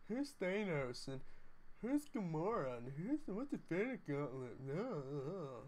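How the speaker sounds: lightly voiced confused girlfriend, slow; a hint of a puzzled girlfriend asking about Marvel; impression of girlfriend. Streamer imitates his confused girlfriend watching Infinity War. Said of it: "You do a great impression of your girlfriend".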